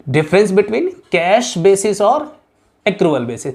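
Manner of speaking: "difference between cash basis" drawn out word by word; slow, spelled-out dictation. Shown as a demonstration. Teacher dictating the question to students, very slowly. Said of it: "नाम बताऊँ".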